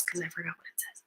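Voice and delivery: fast very soft whisper; quick whisper. Pretend. Teacher pretending to forget, quick whisper to the kids. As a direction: breathe it out quickly. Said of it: "Now I'm doing circle time".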